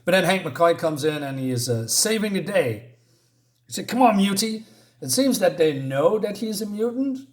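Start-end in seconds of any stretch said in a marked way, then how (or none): 3.9-4.7 sneering taunt. Retold or imitated. Bully taunts Bobby.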